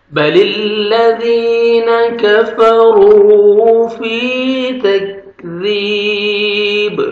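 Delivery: slow, melodic tajweed chanting; Quran recitation. Shown as a demonstration. Teacher reciting the verse for students to repeat.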